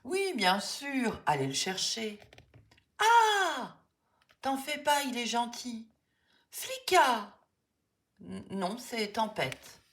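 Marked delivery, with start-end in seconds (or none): 3.0-3.8 sudden high shriek of fright; startled scream. Acted. Kids startled by a big dog, high frightened scream. 6.5-7.4 loud high call of a name, full of hope; calling out to a lost dog; joyful cry. Acted. Boris recognises his lost dog, calling out joyfully.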